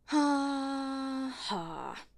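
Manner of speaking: drawn-out, humourless "ha ha"; mock laughter, unamused; sarcastic fake laugh. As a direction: fake a laugh, stretching the first ha. Sarcastic reply to a jibe.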